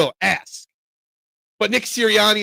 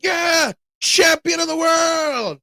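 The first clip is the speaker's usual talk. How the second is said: gloating victory shout; 10 semitones higher, much slower than usual. Retold or imitated. Uncle gloating at his niece over tic-tac-toe, shouting. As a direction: crow like you just won the world title.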